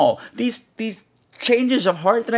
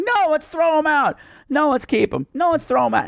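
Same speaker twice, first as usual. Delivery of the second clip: high, back-and-forth imitated squabble; mock bickering; 8 semitones higher than usual. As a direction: mimic indecisive people contradicting each other, pitched up. Mocks characters' flip-flopping argument.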